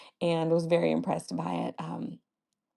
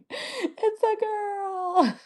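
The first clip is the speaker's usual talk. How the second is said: high, very slow, tearful break; choked-up tears; much slower than usual. Real, not acted. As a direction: let the voice crack with happy tears. Gender reveal, overcome.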